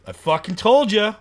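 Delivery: loud, high I-told-you-so; gloating at the promoter who ignored the warning, loud and high. Retold or imitated. Triumphant gloat after the show.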